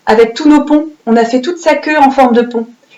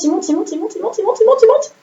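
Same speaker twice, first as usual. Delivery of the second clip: il monte said higher and higher each time; climbing pitch; 9 semitones higher, 4 dB quieter, faster than usual. Shown as a demonstration. Teacher acting out the T rising for children, voice climbing. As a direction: let the voice climb like the pen going up. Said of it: "il part de la ligne et il monte".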